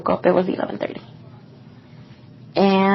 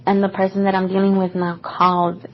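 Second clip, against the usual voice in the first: ordinary delivery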